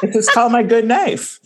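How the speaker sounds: giggling out the knife's name; laughing through it. Real, not acted. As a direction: laugh as you admit it. Laughing while answering.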